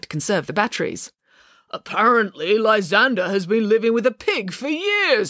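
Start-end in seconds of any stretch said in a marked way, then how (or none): 1.7-5.3 slow, higher character voice; scornful old relative, drawn out; disapproving uncle. Acted. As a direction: announce the scandal with slow disdain.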